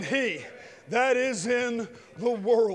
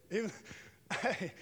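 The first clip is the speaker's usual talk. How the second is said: ordinary talk, nothing marked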